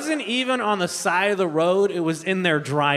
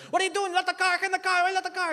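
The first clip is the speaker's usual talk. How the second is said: high-pitched dad on the phone; frantic dad; 14 semitones higher, faster than usual. Acted. Temperamental dad calls daughter at work, high frantic imitation. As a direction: fire off the questions high and frantic. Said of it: "He's like".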